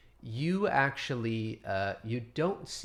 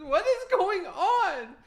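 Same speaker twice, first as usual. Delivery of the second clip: put-on shocked injured girl; 7 dB louder than usual. Moonswallow wailing at the one who flipped onto her. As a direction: cry out in hurt disbelief. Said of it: "She says".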